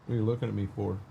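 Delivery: hushed, gentle murmur to the dogs; soft dog talk. Real, not acted. Streamer checks on his dog in the tractor, hushed. Talking softly to dogs in cab.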